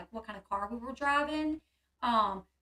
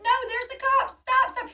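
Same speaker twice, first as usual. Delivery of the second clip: high, loud panicked plea; retold panic, voice shooting right up; 12 semitones higher, 8 dB louder than usual. Woman begging Sean to stop for cops, panicked. Retelling panic as police arrive.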